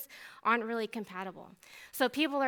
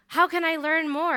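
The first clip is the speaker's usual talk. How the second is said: eager cry; 6 semitones higher, 9 dB louder than usual. Shown as a demonstration. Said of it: "repeated question".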